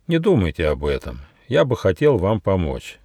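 ordinary delivery

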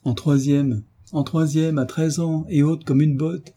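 high, jeering disbelief; sneering. A narrator's reading. Older girl jeering at a small thirteen-year-old, sneering. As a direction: scoff in a high, incredulous voice. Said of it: "Un ricanement lui répondit".

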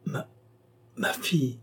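halting, weak, forced out with effort; a dying man pushing out each word; straining to speak. A narrator's reading. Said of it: "Après plusieurs efforts".

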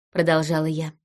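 usual speaking voice

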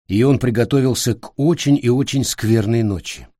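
ordinary delivery

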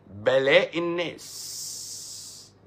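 "الناس" stretched very long on its final s; drawn-out declamation. Real, not acted. Declaims, then repeats it plainly.

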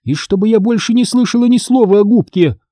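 high, exasperated parting threat; scolding mother's ultimatum; stern warning. A narrator's reading. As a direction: lay down the law to both children.

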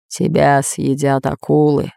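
slow, hollow pronouncement; ghost voice. Acted. Spirit foretells spy's fate, slow ghostly voice. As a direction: deliver doom slowly from beyond.

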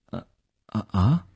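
halting, tongue-tied fillers; at a loss. A narrator's reading. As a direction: stammer hesitantly, unsure what to say. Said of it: "男孩不知该如何说似的".

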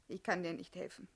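normal talking voice

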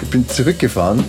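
ordinary talk, nothing marked

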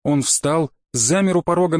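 ordinary delivery

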